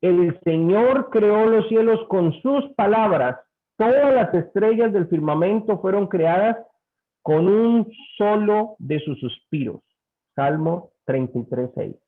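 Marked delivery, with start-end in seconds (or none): 0.0-9.8 slow, raised scripture read; solemn scripture reading. Acted. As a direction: read it like holy words. Lectura bíblica solemne. (lectura bíblica solemne y pausada)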